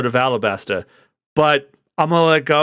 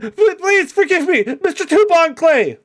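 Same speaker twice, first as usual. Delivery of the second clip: pleading underling; 14 semitones higher than usual. Acted. Underling begging Mister Two.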